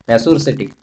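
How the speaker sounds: normal talking voice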